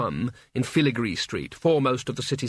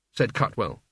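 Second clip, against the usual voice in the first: ordinary delivery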